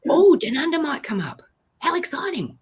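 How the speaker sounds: high, delighted anticipation; giddy, high-pitched excitement; excited. Real, not acted. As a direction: thrill at the news. How exciting.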